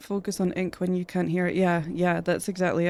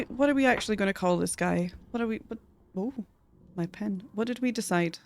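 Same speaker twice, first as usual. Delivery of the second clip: ordinary delivery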